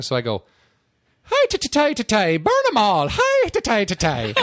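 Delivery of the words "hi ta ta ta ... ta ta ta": very high, loud mock-Irish chant; Simpsons leprechaun. Actor auditioning for an agent, hammed-up Irish leprechaun accent. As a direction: belt out a cartoon leprechaun's Irish jig. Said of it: "Yeah, do the Irish thing".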